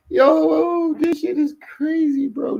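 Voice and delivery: very high squeal after a laugh; cracking up. Real, not acted. Streamer cracking up at a replayed clip, squealing disbelief.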